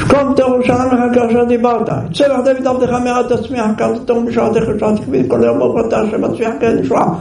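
fast, high rattled-off prayer; in one breath. Retold or imitated. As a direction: race through the prayer without a breath. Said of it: "בנשימה אחת".

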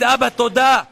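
high and loud, calling out אבא, תודה; fervent thanks. Shown as a demonstration. Rabbi urging listeners to thank the Father, high and loud. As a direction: cry out the thanks upward. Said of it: "תגיד".

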